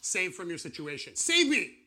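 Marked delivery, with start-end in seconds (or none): 1.2-1.7 sudden high yelp, loud; mock cry for help. Preacher crying out for rescue as a joke, loud yelp. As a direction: yelp it like a drowning man.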